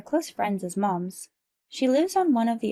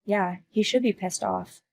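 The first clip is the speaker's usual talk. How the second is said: ordinary delivery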